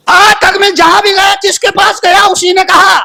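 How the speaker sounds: high, ringing declamation; sermon cry, pitched right up; impassioned preaching. Real, not acted. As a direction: proclaim it to the congregation on a high held pitch.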